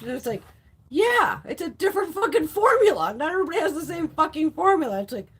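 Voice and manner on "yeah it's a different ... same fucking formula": high, loud mock comeback; exasperated retort. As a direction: argue back emphatically at the critics. Retorts to palette critics.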